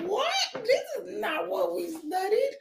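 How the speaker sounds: a high squeaky complaint; mock disbelief. Retells reaction to God's change.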